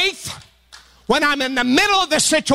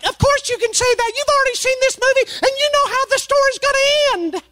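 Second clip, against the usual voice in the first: much higher, fast, indignant retort; annoyed wife. Retold or imitated. Wife retorting to her husband mid-movie, higher and indignant. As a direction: snap back, miffed she's being shushed. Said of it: "And she's like".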